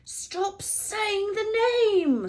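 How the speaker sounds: slow pleading hiss; slow, drawn-out frightened plea; terrified whisper. A narrator's reading. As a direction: beg him to stop, scared. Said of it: "said Ron in a terrified whisper".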